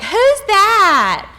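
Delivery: put-on high, loud, drawn-out kid yell; wild young son. Principal imitating her wild son to the audience, high loud child's shout. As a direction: imitate a child blurting out a question. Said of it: "My wild son's ... he's like".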